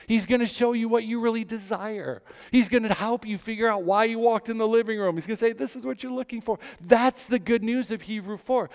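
high, loud, driving sermon climax; full-force preaching. Acted. Pastor to congregation on Hebrews four, fervent preaching. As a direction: pour it out over the crowd with rising fervour.

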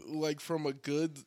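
usual speaking voice

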